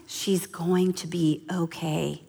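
hushed, measured soothing; slow reassurance. Retold or imitated. Mentor calms the panicking mother, hushed reassurance. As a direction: soothe softly, word by word.

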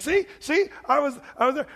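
high, loud, eager pleading; eager protest. Acted. Dead man pointing at the screen for God, eagerly. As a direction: point at the screen like a kid proving himself. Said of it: "he's like".

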